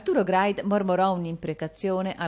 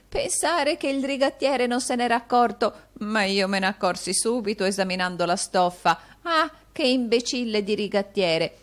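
put-on lively, higher-pitched old man reminiscing; gleeful old man; 7 semitones higher, 4 dB louder than usual. A narrator's reading. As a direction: chuckle over a bargain in an old man's voice. Old miser Arturo reminisces gleefully.

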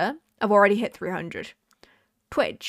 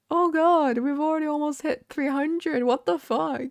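excited disbelief; 5 semitones higher than usual. Retold or imitated. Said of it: "I'm already, like".

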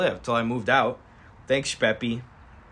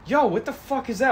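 startled cop; 11 semitones higher than usual. Acted. Cop sees the stick.

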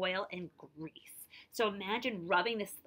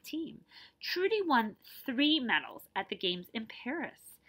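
clear, lively read-aloud narration; story narration; 2 semitones higher, 4 dB louder than usual. A narrator's reading. Teacher reading a picture book to her class, lively narration.

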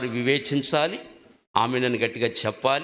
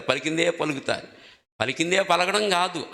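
ordinary talk, nothing marked

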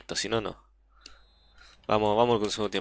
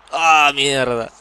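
loud frustrated swearing; angry cursing; 3 semitones higher, 5 dB louder than usual. Real, not acted. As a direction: spit the curses out. Rival scores, repeated cursing.

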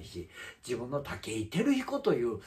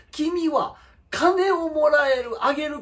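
higher, louder, slow accusation; indignant outburst; 13 semitones higher, 7 dB louder, slower than usual. Real, not acted. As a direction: call him out, loud and drawn out. Heated rant about rival streamer.

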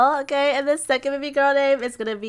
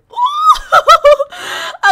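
loud, very high excited whoop; high shrieking laugh; 8 dB louder than usual. Real, not acted. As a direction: let out a squealing laugh. First baby arrives.

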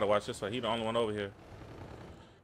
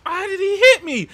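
much higher and louder, jolted; startled yelp; 15 dB louder than usual. Real, not acted. Gamer suddenly hit by an enemy, startled loud yelp. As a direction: yelp as if you just got shot.

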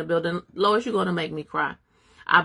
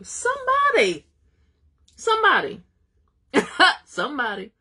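much higher, louder "somebody" dropping back down; exuberant exclaiming; 9 semitones higher, 5 dB louder than usual. Real, not acted. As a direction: cry out in delight, laughing. Preacher exclaiming to congregation.

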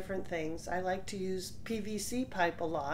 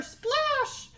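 high, loud splash; exclaimed splash; 4 dB louder than usual. A narrator's reading. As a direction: shout the sound effect. Troll falls into river.

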